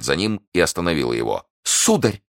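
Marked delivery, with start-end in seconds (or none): 1.6-2.2 high, sudden call; urgent cry. Acted. Prisoner stopping the guard at the door, crying out high. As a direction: call him back at a high pitch. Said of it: "вскричала она".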